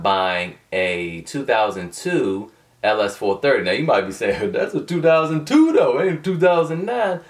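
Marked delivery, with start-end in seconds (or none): 4.5-7.2 lightly voiced doubter, higher pitch; mock objector. Imagined viewer disputing his car, lightly mocked objection.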